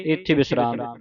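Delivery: normal talking voice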